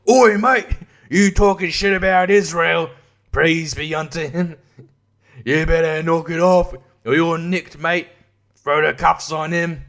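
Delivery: loud barked Oi mate, British copper caricature; mock British cop. Police at the door over a joke, mock British copper threat. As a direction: threaten like a cockney bobby. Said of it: "Not sure if it's Australian or British".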